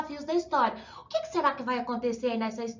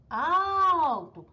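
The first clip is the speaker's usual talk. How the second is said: alto with AL stretched and raised; a long, raised AL opening "alto"; stressed penultimate syllable; 4 semitones higher, 3 dB louder than usual. Shown as a demonstration. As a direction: hold the first syllable of "alto" long and high. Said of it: "sílaba mais forte é a penúltima".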